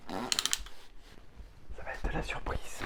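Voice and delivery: hushed playful whisper. Real, not acted. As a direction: breathe it like a secret. Playful whisper before opening box.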